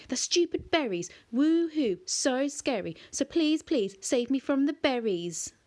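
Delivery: high, loud mock terror; sarcastic mockery. A narrator's reading. Connor mocks the monster's berries with sarcastic fake fear. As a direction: ham up fake fear mockingly.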